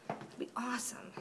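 soft wistful whisper. Real, not acted. Wishing for the convention aloud, whispered. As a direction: whisper it like a wish. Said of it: "whispered aside".

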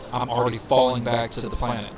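ordinary talk

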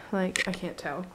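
usual speaking voice

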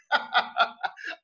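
breathy, voiceless burst of laughter; delighted laugh. Real, not acted. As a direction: laugh happily at your own pun. Host laughs at Highlander pun.

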